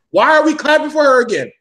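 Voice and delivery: loud, high incredulous why; outraged question. Real, not acted. To the podcast crew, outraged and loud.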